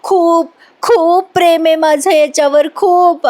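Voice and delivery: imitating a melodramatic heroine crying; hammed-up crying. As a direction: overact a weepy love plea. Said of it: "इतकी रडते, drama करते".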